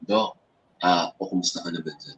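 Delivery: hushed, low-pitched but not whispered. Shown as a demonstration. Host shows the doctor talk that is not whisper, low voice. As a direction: drop it soft and low, short of a whisper. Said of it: "Hindi yan whisper. Low lang yun".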